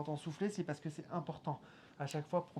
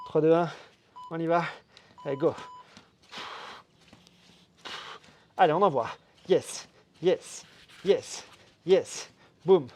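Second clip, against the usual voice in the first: pumped-up coach calling out reps; loud workout coaching; 10 dB louder than usual. Real, not acted. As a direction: drive them hard, loud and energetic. Coach pushes class at full volume.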